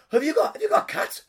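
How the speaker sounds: high, loud panicky question; acted alarm. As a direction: blurt it in hammed-up horror. Faked allergy panic about cats.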